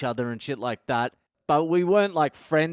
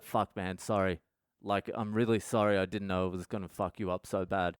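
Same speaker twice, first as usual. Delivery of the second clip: low, soft, subdued apology; retold apology; 7 semitones lower, 7 dB quieter than usual. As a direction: apologise meekly, voice dropped. Friend apologising after a telling-off.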